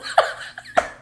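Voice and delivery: breathy little laugh at herself; soft laugh. Real, not acted. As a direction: chuckle quietly. Laughs at her own infomercial voice.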